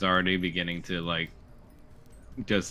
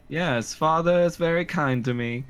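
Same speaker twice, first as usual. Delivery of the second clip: high, fake-accented little boy; earnest son, pitched high; put-on child accent; 9 semitones higher, 4 dB louder than usual. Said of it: "might wanna drop the accent".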